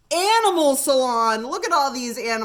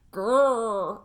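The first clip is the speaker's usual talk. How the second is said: dog growl. Acted. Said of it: "added Fang".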